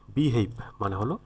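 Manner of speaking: normal talking voice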